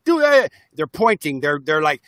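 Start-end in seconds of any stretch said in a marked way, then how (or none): 0.0-0.5 sharp high bark of a commanding parent; bossy inner voice. Retold or imitated. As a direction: jab it out like a pointing finger. Voices in our head pointing.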